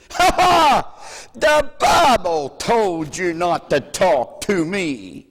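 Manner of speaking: high, loud jeering voice; mocking man. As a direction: jeer at him in a shrill put-on voice. Mocking man quoted in story.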